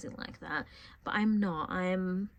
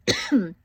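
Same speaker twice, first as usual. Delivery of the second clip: loud, sudden cough; sharp cough; 8 semitones higher, 10 dB louder than usual. Real, not acted. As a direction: cough through a cold. Ill with a cold.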